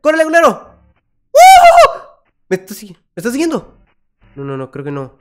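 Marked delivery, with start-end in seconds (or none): 0.0-0.7 gritado, agudo y fuerte; grito de pánico. Real, not acted. Huyendo del monstruo. 1.3-2.0 chillido agudísimo y fuerte con risa; grito que se rompe en carcajada; alarido con risa. Real, not acted. Susto y risa al escapar.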